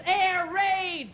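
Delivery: hammed-up war-game shout; screamed warning. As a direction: imitate a boy crying out a raid.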